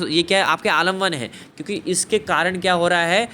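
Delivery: ordinary talk, nothing marked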